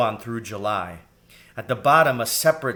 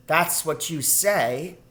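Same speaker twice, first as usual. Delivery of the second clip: put-on drawn-out, higher child's retort; slow, raised young voice of disbelief; sceptical girl rat; 6 semitones higher, slower than usual. A narrator's reading. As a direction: answer back slowly, like a doubting child.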